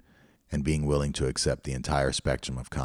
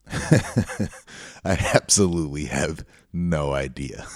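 real laughter breaking into slow words; laughing admission; slower than usual. Real, not acted. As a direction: laugh while stretching out each word. Laughing at his own admission.

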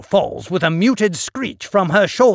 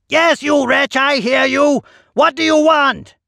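irritated shout; 10 semitones higher, 6 dB louder than usual. A narrator's reading. Master shouts back at servant.

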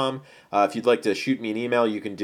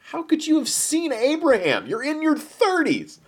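very high-pitched, disbelieving outburst; incredulous. Acted. Crowd doubts Jesus has seen Abraham, incredulous. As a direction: question it in a squeaky, incredulous pitch.

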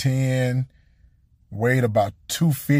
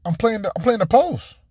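higher, flustered self-defense, stumbling at the start; flustered defending himself; 8 semitones higher than usual. Retold or imitated. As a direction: play the confused player justifying himself to coach. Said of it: "I said".

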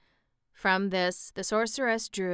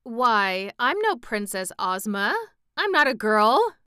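loud protest climbing higher at the end; indignant amazement, building louder; amazed protest; 4 semitones higher, 8 dB louder than usual. A narrator's reading. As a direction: deny it in astonished disbelief. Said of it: "cried Tip in amazement".

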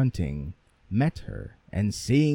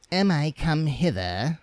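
proud and saucy; 5 semitones higher than usual. Acted. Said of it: "said the proud, saucy one".

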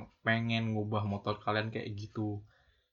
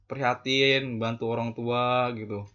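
ordinary talk

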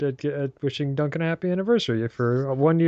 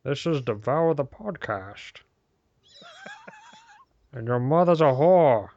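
Sean Connery impression; 2 semitones higher than usual. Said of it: "should we all just do Connor".

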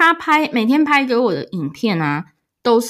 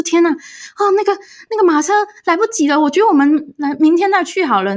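higher, hurried mock panic; tense and anxious; 8 semitones higher than usual. Boyfriend feigning worry that they missed the carriage, tense. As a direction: act out the fake alarm about the carriage. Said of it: "一副很紧张的样子".

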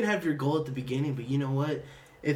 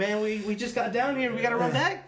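high-pitched imitation of complaining; mock complaint; 10 semitones higher than usual. Player retelling a first freshman game, mock complaint. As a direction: protest in a raised, half-laughing mock whine.

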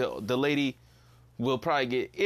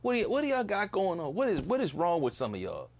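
exasperated retold rant; 8 semitones higher than usual. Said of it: "It's like".

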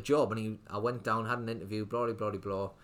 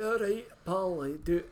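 put-on big, high-pitched Scottish accent; 8 semitones higher, 3 dB louder, slower than usual. Retelling a Glasgow customer walking into the shop, imitated Scottish accent. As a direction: put on a big Scotsman's accent, rough attempt. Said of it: "in his big Scottish accent".

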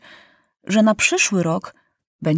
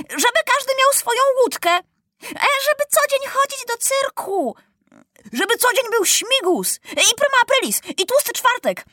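put-on much higher, fast, eager little kid; excited little child; 14 semitones higher than usual. A narrator's reading. Small child deputy shouting wishes, much higher and eager. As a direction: blurt the wishes out breathlessly.

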